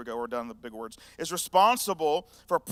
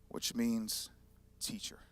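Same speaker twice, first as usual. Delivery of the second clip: breathed out with no voice; whispered meaning of Rabboni; 8 semitones lower, 7 dB quieter, slower than usual. Real, not acted. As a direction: whisper it like a revelation. Whispered translation.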